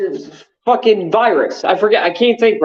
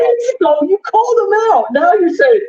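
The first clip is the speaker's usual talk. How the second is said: mock whining cry; 13 semitones higher than usual. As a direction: pitch jumps way up into a whiny mock sob. Ranting, mock whine at Sterling.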